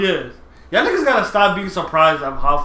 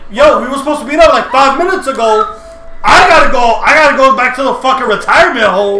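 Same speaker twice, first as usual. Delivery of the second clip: loud yelling, pitched up; loud sharp voice; 8 semitones higher, 10 dB louder than usual. Real, not acted. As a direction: yell it right in his ear. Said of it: "You just yelled in my ear".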